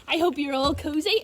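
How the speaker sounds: plain reading voice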